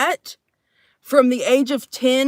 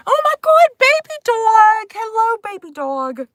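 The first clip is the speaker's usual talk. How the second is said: excited squeal; 11 semitones higher, 4 dB louder than usual. Real, not acted. Streamer greeting baby dog, high delighted squeal. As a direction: squeal it, stretching the words out.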